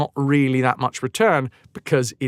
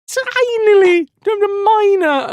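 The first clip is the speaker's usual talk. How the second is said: much higher, slow squeak on tinily; a mock-tiny squeak to belittle the difference; squeaky tiny voice; 5 dB louder than usual. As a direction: shrink the word to a tiny squeak. Mocking how tiny the gain is.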